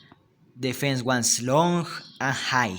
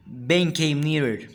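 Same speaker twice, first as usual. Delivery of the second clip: plain reading voice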